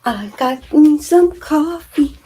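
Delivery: slow, high, gloating sing-song; triumphant. Pretend. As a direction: drag it out proudly. Returning triumphant with coffee.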